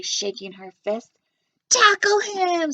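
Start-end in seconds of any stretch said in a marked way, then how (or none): 1.7-2.7 high, loud, slow scream. A narrator's reading. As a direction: lunch ladies urging the chase. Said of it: "screamed the lunch ladies".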